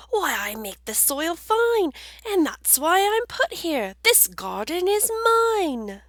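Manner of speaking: high, loud worm voice; proud worm. Acted. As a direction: insist the garden is yours. Worm speaking in story.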